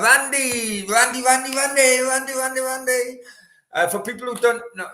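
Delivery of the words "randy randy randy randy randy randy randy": excited name-calling. Real, not acted. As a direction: greet a friend with a high sing-out, stretching it.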